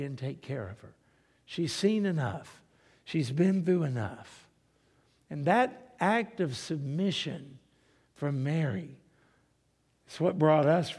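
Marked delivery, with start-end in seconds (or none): none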